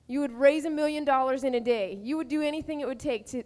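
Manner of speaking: ordinary talk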